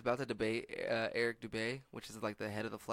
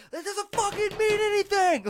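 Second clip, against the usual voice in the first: shrieking mock fury, much higher and louder; mock furious scream; 12 dB louder than usual. Comedian yells at commenters saying inertia, hammed-up shriek. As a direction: scream it like he's lost his mind. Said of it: "getting really mad".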